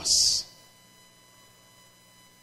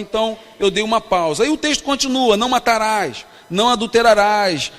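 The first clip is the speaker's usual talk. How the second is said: fervent preaching; 5 dB louder than usual. Acted. Pastor preaching to a youth congress, fervent and emphatic. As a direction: preach it to the crowd, building.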